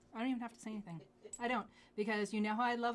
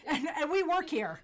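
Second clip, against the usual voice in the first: laughing, higher and louder; giggling through the words; 8 semitones higher, 9 dB louder than usual. Real, not acted. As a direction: crack up mid-line. Joking about coveting own stock.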